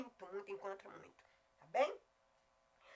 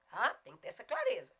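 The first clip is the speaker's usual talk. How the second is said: ordinary delivery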